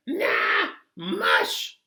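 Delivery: high, loud cartoon passenger, slow and sure; odd passenger voice. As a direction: squeak it out like a goofy know-it-all.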